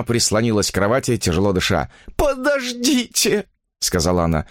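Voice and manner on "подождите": high, drawn-out appeal; weak woman pleading for time; desperate plea. A narrator's reading. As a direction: beg him to wait, slowly. Exhausted woman begs for delay.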